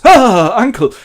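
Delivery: high, loud joyful gasp; delighted surprise. Acted. Nephew greets Scrooge at the door, joyfully surprised. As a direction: exclaim loudly, thrilled to see them.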